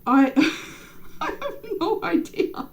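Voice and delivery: giggling through the words; laughing. Real, not acted. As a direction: laugh it out, bashful. Laughs at the island question.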